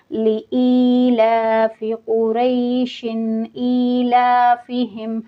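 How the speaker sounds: slow melodic chant linking कुरैशिन into ईलाफिहिम; continuous recitation. Shown as a demonstration. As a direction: recite without a breath break, kasra kept. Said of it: "ऐसे मैं पढ़ूँगी". (बिना सांस तोड़े जोड़कर धीमी तिलावत)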